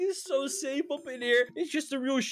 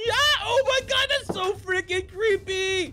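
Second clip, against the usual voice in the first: high, loud scared cry; startled scream; creeped-out yelp; 7 semitones higher, 3 dB louder than usual. Real, not acted. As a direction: recoil in fright. Creeped out by the baby.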